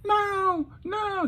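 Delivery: very high falsetto wail; acted crying scream. Mandy starts freaking out, acted high screaming wail. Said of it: "She starts screaming and crying".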